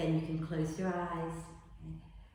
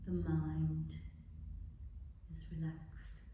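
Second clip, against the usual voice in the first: guided meditation; 8 dB quieter than usual. Acted. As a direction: guide them gently into relaxing. Leads a body relaxation.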